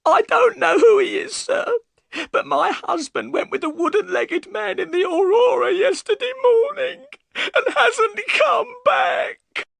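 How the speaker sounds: high, loud, crying cry of worry; tearful wife crying out her fear; sobbing shout. Acted. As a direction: wail it through tears. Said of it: "and burst into tears".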